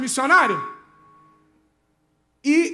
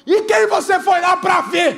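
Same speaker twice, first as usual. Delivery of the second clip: loud, higher, slow accusing question; mother's loud challenge; 6 semitones higher, 6 dB louder, slower than usual. Retold or imitated. Mother confronting her son about the vigil, loud and slow. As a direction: call him out like a suspicious mum.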